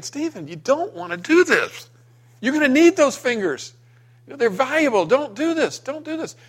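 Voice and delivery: father begging his little son to stop, high and strained; anxious pleading. Retold or imitated. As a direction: plead with rising desperation.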